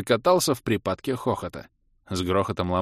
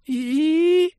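очень высокий протяжный писк; проскули тоненько-тоненько; тоненький скулёж; 4 dB louder than usual. A narrator's reading. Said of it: "тоненько проскулил". (жалобный тонкий писк)

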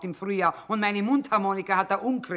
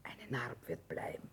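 hushed whisper, barely voiced; breathy hushed aside; 14 semitones lower, 16 dB quieter than usual. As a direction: whisper it so the girl can't hear. Quiet remark over wounded daughter.

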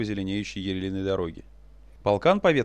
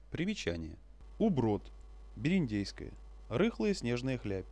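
footnote aside; 11 dB quieter than usual. A narrator's reading. Footnote read aside, softer.

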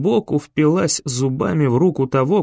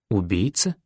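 lower, softer, rising disbelief; stunned question; 5 semitones lower, 5 dB quieter than usual. A narrator's reading. Ben echoing the gunman's accusation, stunned and quiet. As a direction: quietly repeat the word убийца as a stunned question.

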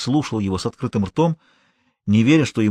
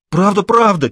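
higher, louder desperate repeating; a cornered thief begging to be believed; insistent pleading; 9 semitones higher, 5 dB louder than usual. A narrator's reading. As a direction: keep insisting, scared. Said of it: "твердил Шеренец".

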